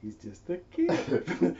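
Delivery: slow, soft, easygoing; calm reassurance. Retold or imitated. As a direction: settle her down slowly and gently. Father calms the mother.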